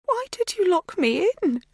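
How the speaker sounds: high, hurt plaintive question. A narrator's reading. Magda asking Kate why she locked her in, plaintive. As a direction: sound wounded and baffled. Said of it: "Her voice is plaintive".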